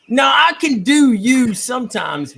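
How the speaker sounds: hammed-up attempt at British English; British impression. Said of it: "You gotta work on your British impression".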